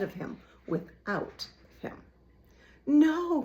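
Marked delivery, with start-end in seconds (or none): 2.9-3.4 sharp, high, loud cry; desperate cry of no. A narrator's reading. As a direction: shout it out in alarm. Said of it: "cried Despereaux".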